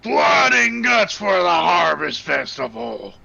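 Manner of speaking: loud eerie mad proclamation; cursed gibberish voice. Acted. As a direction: announce it like a deranged herald. GM voices cursed NPC nonsense.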